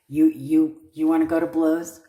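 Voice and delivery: loud, fast, squaring up in play; mock fight challenge. Reader mock-challenging the querent's rival, loud and fast. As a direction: dare them to swing, loud and quick.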